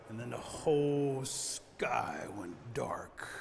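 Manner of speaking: slow, low, hushed storytelling; low suspenseful half-whisper; ominous narration. A narrator's reading. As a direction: stretch it out with foreboding.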